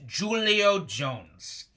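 Julio Jones drawn out, each syllable slow and deliberate; slowly pronounced name. Shown as a demonstration. Host teaching fans the name, slow and deliberate. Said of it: "his name is pronounced".

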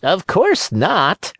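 high, slow cat echo. Acted. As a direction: parrot the fox like a cat. Cat echoes.

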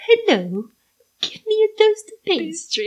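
Brazilian attempting an English accent; high, struggling mock English accent; badly faked English accent, laughing at the end. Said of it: "Brazilian doing an English accent".

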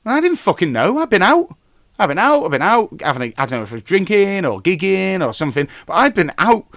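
indignant storyteller telling how the dog died; outraged. Real, not acted. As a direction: rant it like you still can't believe it. Outraged retelling of dog news.

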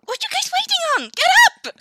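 high, very loud yell; impatient shout. Acted. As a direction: rouse the party with a shout. Calls on companions to act.

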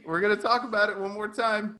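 ordinary talk

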